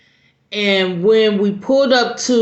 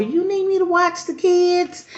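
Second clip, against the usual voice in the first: much higher, sugary offer; fake-sweet babysitter; 11 semitones higher than usual. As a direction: put on a sweet helpful voice. Babysitter offers to watch kids.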